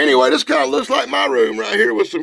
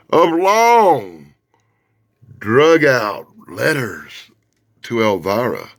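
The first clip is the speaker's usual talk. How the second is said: "drug out letters" stretched slow and lower; drawn-out delivery; 8 semitones lower, slower than usual. Shown as a demonstration. Vlogger mocking the endless fan letters, slow drawn-out words. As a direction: drag out "long" and "drug out letters" to mock their length. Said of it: "I'm not kidding when I say long".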